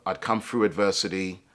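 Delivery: ordinary delivery, nothing marked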